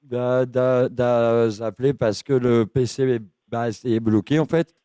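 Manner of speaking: low, halting, lonely oddball; dopey confused voice. Acted. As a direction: play a muddled lonely man rambling. Keeps confused caller character.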